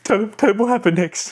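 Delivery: high, quick, squawky pirate; Ragetti. Shown as a demonstration. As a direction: urge him on in a high, fast character voice. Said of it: "when you combine them both together".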